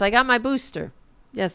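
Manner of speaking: normal talking voice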